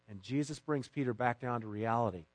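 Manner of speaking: ordinary talk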